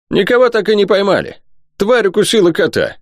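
very high-pitched character voice; excited high voice. Acted. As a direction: pitch it far up, agitated. Excited reply in acted dialogue. (взволнованно, тонким голосом)

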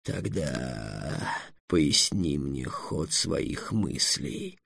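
low, soft, very slow threat; deep dragon voice, ominous; menacing dragon. A narrator's reading. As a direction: demand it slowly with quiet menace. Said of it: "угрожающе потребовал дракон".

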